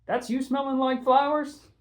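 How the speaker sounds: a high, teasing question; teasing Coach. Acted. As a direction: needle them about the perfume. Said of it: "Coach asked Patty".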